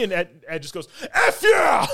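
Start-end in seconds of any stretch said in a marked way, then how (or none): 1.1-1.9 high, loud mock cheer; hyped yell. Retelling the drunk friend getting hyped by the rant, excited yell. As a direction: shout it as if carried away. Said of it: "he got hyped into it".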